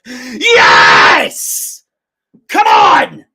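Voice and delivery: loud, drawn-out celebration cry; triumphant shout. Real, not acted. Fan celebrating the comeback, triumphant shout. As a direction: roar it out in victory.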